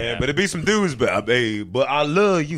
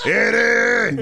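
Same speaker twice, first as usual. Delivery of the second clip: calling out; 6 semitones higher than usual. Acted. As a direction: shout the name across the room. Calling out to Eddie Murphy.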